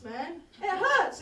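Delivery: normal talking voice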